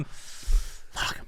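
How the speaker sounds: breathy whispered fuck; whispered curse. Retold or imitated. Caught teen cursing to the manager, whispered. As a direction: mutter it under your breath, caught.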